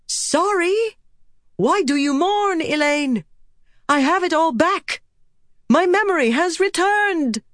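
very high, joyful exclaiming; overjoyed, brushing away a friend's grief; exultant, pitched far up; elated. A narrator's reading. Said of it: "She laughed".

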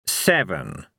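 slow, godly echo of SEVEN; Fate, unruffled. Acted. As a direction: say SEVEN with measured gravity. Fate echoes Cohen's call.

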